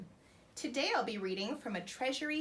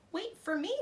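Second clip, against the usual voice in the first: croaking frog; 8 semitones higher than usual. Acted. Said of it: "croaked the frog".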